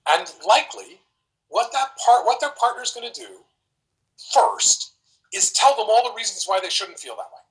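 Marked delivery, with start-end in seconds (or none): none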